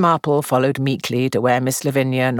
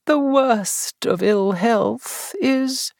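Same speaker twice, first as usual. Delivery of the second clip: high, slow, sickly lament; melancholy; 9 semitones higher, much slower than usual. A narrator's reading. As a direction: sigh it out like an invalid. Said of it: "in a melancholy tone".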